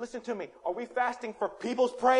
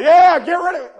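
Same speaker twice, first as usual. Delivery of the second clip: loud, raised-pitch hollering; mock rowdy cheer; 9 semitones higher, 18 dB louder than usual. Congregant roused by talk about Obama, hollering in agreement. Acting crowd cheering political remark.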